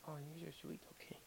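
very soft tender murmur; barely voiced, tender to the pet; cooing at the dog. Real, not acted. To his dog who looks cute, very soft tender coo.

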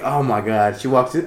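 usual speaking voice

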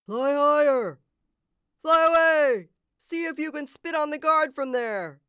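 loud, high heckling shouts; calling out. A narrator's reading. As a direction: heckle from the crowd below. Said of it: "called out unhelpful advice".

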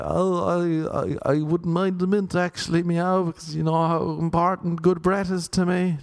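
high-pitched, stammering ramble; retold flustered rambling, pitched high; nervous rambling. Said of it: "Just rambling out words".

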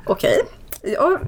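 high, laughing okay; mother cracking up at son; laughing, squeaky agreement. Retold or imitated. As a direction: giggle while giving in. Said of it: "Jag bara".

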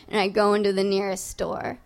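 usual speaking voice

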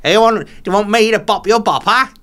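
hammed-up comedian impression; Alan Carr. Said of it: "I would ask questions of Alan Carr".

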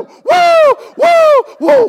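high whooping screams; screaming woo. Pretend. Believers before God's glory, screaming high whoops. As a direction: whoop at the top of your voice. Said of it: "after we go".

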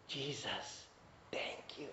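hushed, breathless whisper of gratitude; whispered thanks. Acted. Healed man whispering thanks to Jesus, hushed. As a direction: whisper it at his feet.

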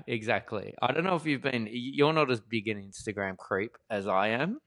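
ordinary talk

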